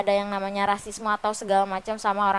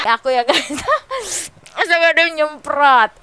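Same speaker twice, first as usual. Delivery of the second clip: startled laughing; 5 semitones higher, 6 dB louder than usual. Real, not acted.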